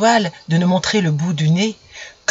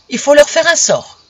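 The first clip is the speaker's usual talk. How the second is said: higher, louder furious sun decree; angry cry; 8 semitones higher, 5 dB louder than usual. Acted. Furious sun shouting at his wife about the children. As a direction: shout it as a raging husband. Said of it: "cria-t-il".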